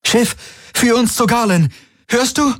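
very high, pressing command; urgent appeal. A narrator's reading. Kirai orders the silent ship, high urgent appeal. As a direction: demand an answer in a high pitch.

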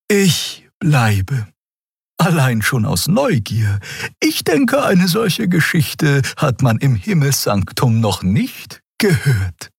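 high, measured character voice; curious crew member. Acted. Xia deciding to stay out of curiosity, high and measured. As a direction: answer with calm curiosity, pitched high.

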